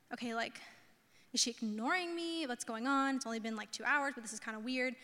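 soft, musing inner voice; quiet puzzlement. Retold or imitated. Fiance wondering at unanswered calls, quietly puzzled. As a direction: think it through softly, mildly puzzled.

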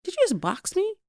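can't believe she just got slapped; shocked disbelief. Retold or imitated. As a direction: daughter to mom after the slap, stunned.

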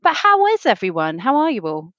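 ordinary delivery

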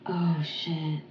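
shocked whisper. Real, not acted. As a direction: breathe it out in shock. Shocked reaction to crash news.

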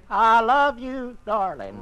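mock passionate romance reading; hammed-up love line. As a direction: read the lover's line aloud like a swooning hero. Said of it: "whispered hoarsely".

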